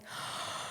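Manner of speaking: sharp acted intake of breath; shocked gasp. Girlfriend realising he is about to propose, shocked gasp. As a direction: gasp as if it just dawned on you.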